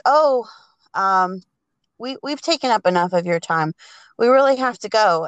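fast, flustered excuse to leave; hurried excuse. Acted. Susan pulling Albert away from the professor, fast and flustered. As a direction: rush it out, trying to get away politely.

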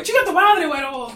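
squeaky mock-mother curse; imitating mom. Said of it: "Llega tu mamá y".

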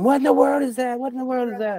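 high, frantic asking; panicked friend. Retold or imitated. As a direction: mimic a spooked buddy. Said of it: "he was back there going".

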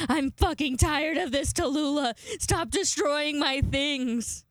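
high-pitched exasperated telling-off; hammed-up fed-up owner, dragging out the last words; mock scolding. Retold scolding of the dog.

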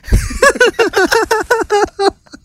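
high cackling laugh; loud burst of laughter. Real, not acted. Bursts out laughing mid-read.

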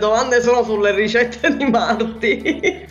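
breaking into giggles mid-apology; trying not to laugh; giggling. Real, not acted. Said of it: "mi viene da ridere".

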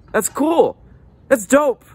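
high, loud hype; hyped up. Acted. Sarcastic reaction.

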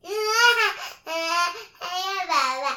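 loud mock wail for daddy; child's loud pretend sob calling daddy; pretend crying. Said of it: "怎么哭？".

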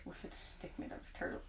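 fast hushed whisper. Real, not acted. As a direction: slip it in as a sly whisper. Whispered suggestion.

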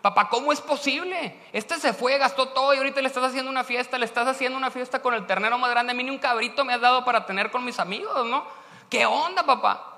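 put-on older brother fuming at father; angry older son. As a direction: protest the unfairness to dad. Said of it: "se enoja tanto y dice".

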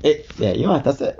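plain reading voice

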